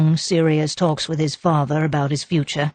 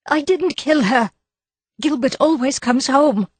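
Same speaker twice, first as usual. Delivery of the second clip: high, pleading denial; cling to your belief in him; desperate wife; 10 semitones higher than usual. A narrator's reading. Wife desperate before the inspector. Mrs Gower voiced higher.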